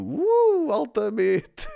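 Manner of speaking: high, loud gleeful whoop; delighted. Retold or imitated. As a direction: whoop like a thrilled teenager. Retold delighted reaction to coffee. (entusiasmo adolescente exagerado)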